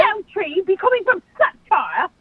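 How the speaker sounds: wobbly mock English accent; put-on English accent. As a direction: do a badly faked English accent. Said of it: "Is that your English accent?".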